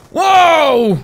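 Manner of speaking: high loud wow; excited shout. Real, not acted. Gamer winning a short intense game, excited shout. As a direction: cheer the win. Win reaction.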